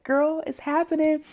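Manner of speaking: high-pitched retold excitement; excited news. Cook telling her friend the event is on, excited.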